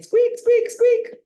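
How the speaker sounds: high, loud squeaking like a little hamster; excited hamster squeak. A narrator's reading. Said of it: "I hear Humphrey saying".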